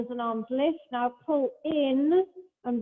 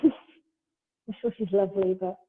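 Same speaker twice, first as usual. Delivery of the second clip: laughing; 5 semitones lower than usual. Real, not acted. Laughing at own dislike.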